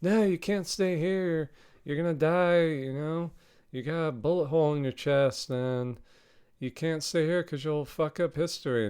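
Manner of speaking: lightly voiced Helena begging Indy not to stay; Helena pleading. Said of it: "She's like".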